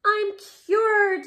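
high, loud joyful shout. Acted. Camilla cured, a high joyful shout. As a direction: burst out with relief. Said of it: "she shouted".

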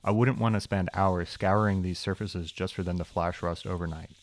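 usual speaking voice

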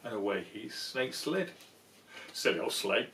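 ordinary delivery, nothing marked

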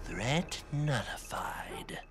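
slow unvoiced whisper; slow hushed report. Robot quietly reports threat gone.

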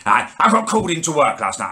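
normal talking voice

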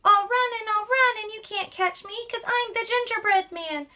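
high, boastful gingerbread man taunting; high cheeky runaway cookie; cocky gingerbread man. Acted. Gingerbread man voiced in story.

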